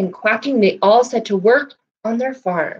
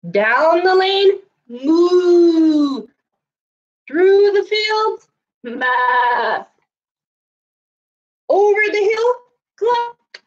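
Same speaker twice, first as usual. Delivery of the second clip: excited chase; 9 semitones higher, much slower than usual. A narrator's reading. Chase over the farm.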